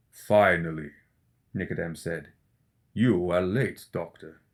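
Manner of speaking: put-on impatient old necromancer; Nicodem, cold and stern. Nicodem voiced by narrator.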